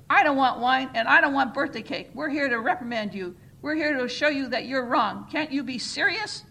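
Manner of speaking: loud, scolding chairperson; angry reprimand. A narrator's reading. The chairperson scolds the smiling congregation, loud and angry. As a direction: hammer home the reprimand.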